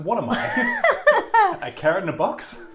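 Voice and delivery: high, loud, laughing question; laughing mock outburst. Host retelling own reaction to mind games, laughing mock outburst. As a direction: cry it out high through laughter.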